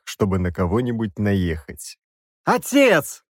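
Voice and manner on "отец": high, loud plea; wailing cry. A narrator's reading. Princess wails to her father to stop the execution. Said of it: "возопила королевна".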